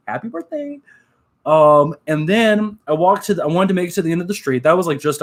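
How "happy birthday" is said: a sweet little birthday wish, pitched way up; high soft character greeting. Retold or imitated. Fursuiter greets a stranger's daughter on video, high and soft. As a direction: voice it much higher and softer, like a cute mascot.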